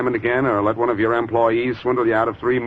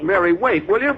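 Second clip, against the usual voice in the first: raised, higher call to stop her leaving; calling after her; 10 semitones higher than usual. Acted. Blackie calls after Mary as she storms out, raised urgent call. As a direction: call out to someone walking away.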